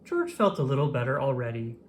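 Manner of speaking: usual speaking voice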